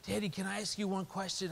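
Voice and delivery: hint of a pleading daughter; little girl voice.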